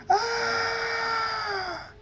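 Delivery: very high, long held scream; scream of pain. A narrator's reading. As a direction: shriek high and hold it through the punch. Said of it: "Thunderstorm screams".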